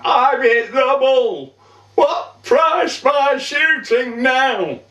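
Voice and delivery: high-pitched, triumphant boast; proud crowing. Acted. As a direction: crow to the sergeant over your lucky shot. Sam crows about his shot.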